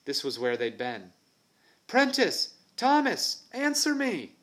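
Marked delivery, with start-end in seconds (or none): 1.9-4.3 high, loud desperate yell; desperate shouting. Acted. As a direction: scream for the missing friends. Boy searching empty spot, panicking.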